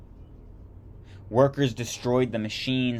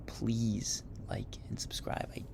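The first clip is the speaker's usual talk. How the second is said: whisper; 8 dB quieter than usual. Real, not acted. Whispered request to viewers.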